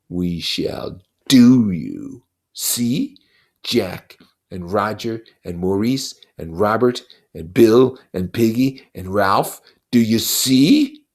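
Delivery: put-on a buzzing devil taunting a child; Lord of the Flies, mocking. Lord of the Flies taunting Simon, slow and sneering.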